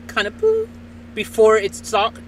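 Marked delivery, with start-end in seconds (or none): 0.4-0.7 short high-pitched "boo" beep; imitated beep. Showing listeners the app's beep before it talks, imitated beep. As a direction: mimic a little electronic beep. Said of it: "there's a little beep".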